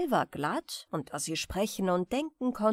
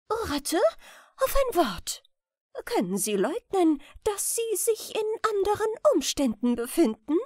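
queen confronting the rat, raised and indignant; stern queen; 8 semitones higher, 4 dB louder than usual. A narrator's reading. Queen confronts her rat friend, higher and louder, indignant. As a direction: reproach her friend from on high.

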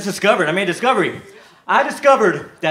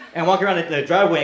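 ordinary delivery

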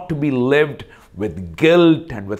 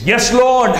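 high, louder, exasperated reply; irritated, getting angry; 11 semitones higher, 6 dB louder than usual. Retold or imitated. Peter answering Jesus's repeated question, irritated. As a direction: answer back with rising irritation, pitched up. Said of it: "he's actually irritated. He's getting angry".

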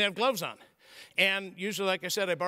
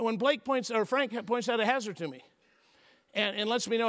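normal talking voice

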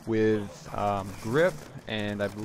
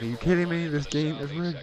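louder, higher exasperated complaint, half joking; mock angry outburst; 6 semitones higher, 4 dB louder than usual. Gamer retelling getting killed, mock angry outburst. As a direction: vent at the rigged game in mock fury. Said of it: "I was so mad".

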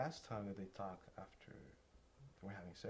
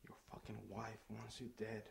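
normal talking voice

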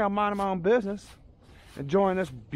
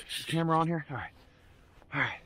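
ordinary talk

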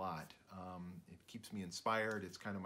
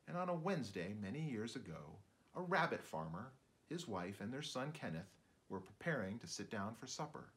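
ordinary delivery, nothing marked

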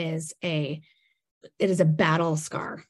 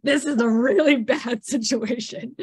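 a touch of mock kid panic, slower and louder; imitating little boy; 4 semitones higher, 5 dB louder, slower than usual. Mother voicing her younger son, lightly mock alarmed. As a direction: play the little brother fretting over the blocks. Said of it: "Charlie's, like, building the blocks".

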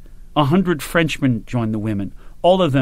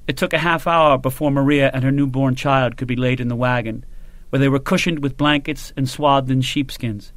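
usual speaking voice

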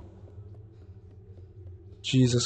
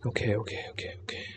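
tense whispered okays; whispering; 11 dB quieter than usual. Real, not acted. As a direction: whisper to calm yourself. Hiding in the dark.

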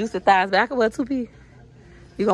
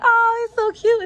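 gushing parent; 4 dB louder than usual. Retold or imitated. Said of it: "You be like".

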